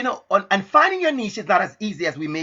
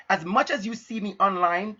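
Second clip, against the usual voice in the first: normal talking voice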